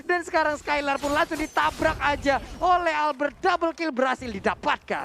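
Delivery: loud, high teamfight shout; excited caster call. Acted. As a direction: hype up the double kill. (teriakan caster yang heboh)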